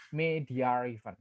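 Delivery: "media even" with an R inserted between the words; intrusive R. Shown as a demonstration. Lecturer showing students an intrusive R, "media even" joined by R. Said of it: "Itu ada, ada R sound-nya".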